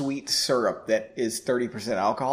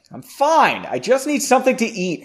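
raised, higher voice, insistent; loud protest; 8 semitones higher, 6 dB louder than usual. A narrator's reading. Henry insisting to Margot he needs food, loud and insistent. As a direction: protest loudly from the next room. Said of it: "Henry said loudly".

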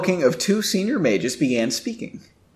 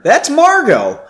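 pitched up, louder and delighted; suddenly louder, glad recognition; 11 semitones higher, 5 dB louder than usual. A narrator's reading. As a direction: call her name out happily. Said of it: "she said somewhat louder".

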